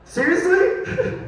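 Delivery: high, slow drawn-out seriously; mock outrage. Comic to audience reacting to his coming out, mock indignant. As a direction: stretch it out, high and incredulous.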